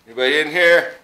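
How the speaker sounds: loud call into an empty building. Real, not acted. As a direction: call out to whoever's inside.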